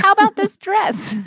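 high, sing-song mocking adult; mock grown-up voice. Retelling the costume people, high mock sweet voice. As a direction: put on a high fussy costume lady. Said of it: "They're like".

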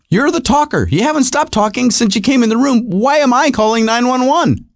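high-pitched, indignant comic rant; exasperated husband. Retold or imitated. Acted quarrel with his wife.

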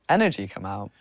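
normal talking voice

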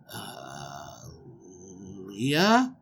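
very soft, halting, rising at the end; hesitant question. Acted. Groom to the wrong bride in bed, hesitant. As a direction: tentatively check who is there.

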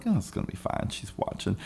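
whispered, unvoiced reassurance; whispered relief. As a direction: whisper it to yourself. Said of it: "I'm like".

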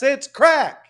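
high, showy name call; hype announcer. Acted. Announcing the guest.

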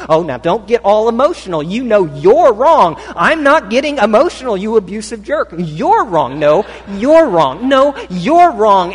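preacher acting out a couple's fight, shouting; mock heated shouting match. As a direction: yell it like the fight has peaked. Argument climax.

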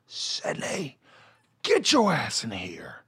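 hint of a stern mom calling from the kitchen, slow. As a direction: call him in like an angry mother. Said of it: "she said".

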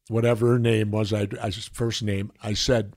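ordinary talk, nothing marked

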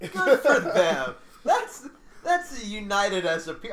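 loud, slow laughing cheer; laughing approval. Real, not acted.

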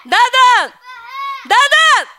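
shouting a name across the room; loud repeated name call. Real, not acted. Calling out loudly to someone.

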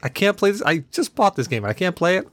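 high, stunned "really?" rising in outrage; incredulous disbelief. Retold or imitated. Gamer who just bought a game, incredulous. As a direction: voice your shock at a game that won't run. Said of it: "one of those kick in the teeth of".

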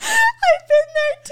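very high, loud, drawn-out squeal; shrieked agreement. Real, not acted. Friend relating to the shame story, shrieking. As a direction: squeal it slowly in delighted recognition.

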